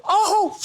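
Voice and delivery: high, loud elated shout into laughter; joyful whoop. Retold or imitated. Freshman whooping when the coach believes in him, loud and high. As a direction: whoop with pure joy.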